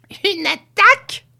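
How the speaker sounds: high, loud, drawn-out question; indignant cry. A narrator's reading. Grandmother scoffs at her son's warning, crying out. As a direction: throw it back at high pitch. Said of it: "cria-t-elle".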